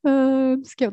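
imitating a scared little kid; mock-frightened child voice. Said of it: "like a scared little kid".

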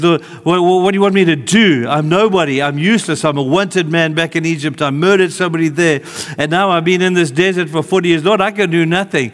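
high, loud, desperate protest; frantic Moses refusing the call; pleading Moses. Acted. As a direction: beg God to pick someone else.

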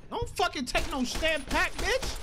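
loud, high-pitched trash talk; combat yelling. Real, not acted. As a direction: shout the enemy down mid-fight. Yelling at enemy in firefight.

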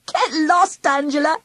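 giggled. A narrator's reading. Charlie giggles at Angela, brushing her off. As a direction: giggle as you push her away. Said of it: "I giggled".

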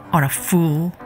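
ordinary delivery, nothing marked

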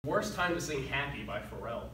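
ordinary delivery

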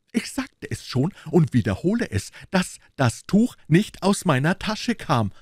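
high, insistent musketeer voice; Aramis. A narrator's reading. Musketeer repeats his denial, high and insistent.

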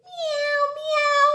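very high little mews; kitten meowing. Pretend. Teacher showing children a playing kitten, high meows. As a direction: mew like a playful kitten. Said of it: "Look, the kitten's playing".

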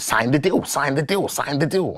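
high-pitched imitation of a gremlin. Retelling the manager's repeated demand, high gremlin voice. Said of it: "His voice just changed into, like, a gremlin".

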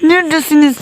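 high-pitched squeal of alarm; startled, squeaky search call; panicked cry. Real, not acted. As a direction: voice shoots up in panic. Panicked search for the game's monsters.

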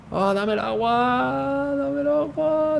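high, slow begging whine; whiny pleading. Pretend. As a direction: beg for it pitifully, drawn out. Begging teammate for water.

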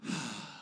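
soft, breathed-out sigh; contented sigh. Retold or imitated. Guests see their name at the table, soft contented sigh. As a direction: let out a low sigh of relief. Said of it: "you could always hear this wonderful sigh".

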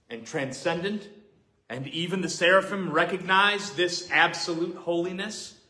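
usual speaking voice

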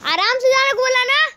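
loud, high telling-off; exasperated scolding. Real, not acted. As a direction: yell at him for not listening. Scolds character after fall. (झुंझलाकर ज़ोर से डाँटना)

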